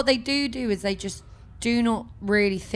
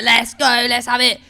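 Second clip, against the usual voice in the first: louder, rowdy festival crowd cheer; hyped-up crowd yell; 2 semitones higher, 7 dB louder than usual. Retold or imitated. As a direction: imitate punks egging each other on. Acting out rowdy young festival crowd.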